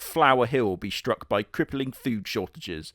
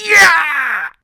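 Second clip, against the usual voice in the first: much higher, much louder attack yell; battle yell; 14 dB louder than usual. Shown as a demonstration. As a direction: leap down from the tree yelling. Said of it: "you just go".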